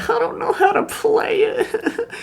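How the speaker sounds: very high, slow fake sob; squeaky, drawn-out crying impression; mock crying. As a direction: imitate a bawling classmate, hammed up. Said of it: "she's just crying".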